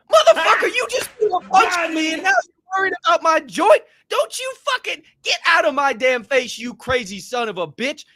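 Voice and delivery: high, loud re-enacted outburst; outraged yelling. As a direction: yell in disbelief, re-enacting the fight. Acted outburst at the attacker.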